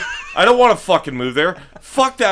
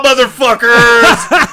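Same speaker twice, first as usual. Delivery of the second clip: drawn-out shout; 3 semitones higher, 3 dB louder, much slower than usual. Acted. Mock-bragging about Utah wives.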